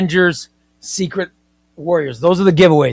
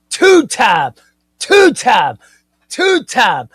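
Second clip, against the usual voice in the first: loud, higher chant of two-time; shouted repeat; 6 semitones higher, 7 dB louder, much slower than usual. Shown as a demonstration. Repeating the viewer's title on request, loud chant. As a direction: hammer two-time each time louder. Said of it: "say it again".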